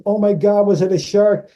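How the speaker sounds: high, imitated astonished question; retold amazement. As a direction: act out her dawning realisation. Co-star guesses in amazement.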